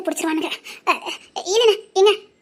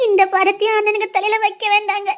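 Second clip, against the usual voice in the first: tearful plea; weeping, burdened request; crying. Acted. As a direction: beg him through sobs. Wife pleads through tears.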